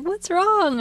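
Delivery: high-pitched imitation of a concerned kid; worried little child. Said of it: "Evan is so sensitive".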